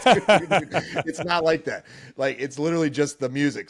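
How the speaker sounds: high, loud, laughing protest; flustered laugh. Retold or imitated. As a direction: brush it off to son, laughing. Reassuring son after crying.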